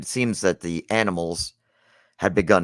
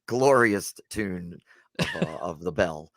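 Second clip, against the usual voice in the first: normal talking voice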